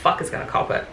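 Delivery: gleeful whisper under the breath; whispered aside. Retold or imitated. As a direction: mutter it to yourself before the fight. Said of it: "And I'm like".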